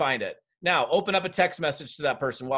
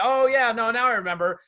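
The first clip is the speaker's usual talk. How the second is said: high, loud burst of remembering; put-on "aha" moment, pitched up; sudden recognition; 8 semitones higher, 5 dB louder than usual. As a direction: act out it suddenly coming back to you. Acted reply recognising a forgotten contact.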